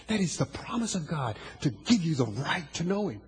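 usual speaking voice

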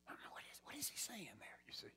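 sudden hushed whisper, almost breath only. Real, not acted. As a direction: lean in and whisper the question. Preacher drops voice mid-sermon.

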